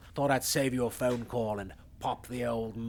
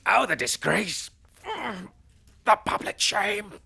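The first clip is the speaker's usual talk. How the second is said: melodramatic lament; 9 semitones higher than usual. Acted. Mock lament over disgrace.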